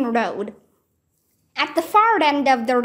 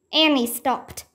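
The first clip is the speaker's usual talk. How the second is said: ordinary delivery, nothing marked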